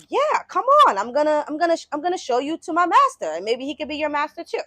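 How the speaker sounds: high, keen invitation, loud at first; eager dog. Acted. Dog offering the wolf his master, eagerly. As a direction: play a dog eagerly bringing a friend along.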